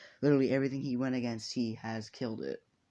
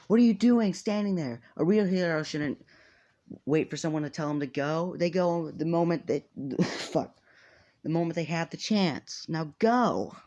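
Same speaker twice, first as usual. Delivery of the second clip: a loud, higher shout over the speakers; 6 semitones higher, 5 dB louder than usual. Retold or imitated. Mike on the microphone shouting at students standing around, loud. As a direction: bark the order at the students to get moving. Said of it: "on the microphone, just says".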